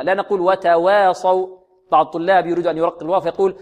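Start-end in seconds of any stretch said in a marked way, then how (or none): 0.4-1.6 "وتواصوا" with the long a bent towards e; over-tilted waw vowel. Shown as a demonstration. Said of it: "لا نقللها ولا نميلها".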